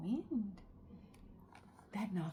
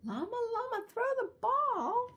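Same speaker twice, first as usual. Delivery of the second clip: excited friend calling out; 12 semitones higher, 5 dB louder than usual. Acted. Friends call to Llama in play.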